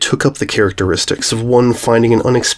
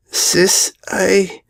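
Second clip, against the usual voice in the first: choking up; 9 semitones higher than usual. A narrator's reading. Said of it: "Sweetie choked as her eyes moistened".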